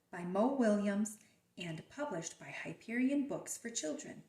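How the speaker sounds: ordinary delivery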